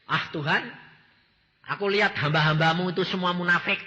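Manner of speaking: loud, indignant protest to God; a youth grumbling at God about hypocrites; complaining to God. Retold or imitated. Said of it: "mbak Tuhan gini". (protes keras kepada Tuhan)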